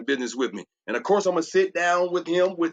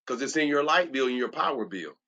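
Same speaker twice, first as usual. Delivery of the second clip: usual speaking voice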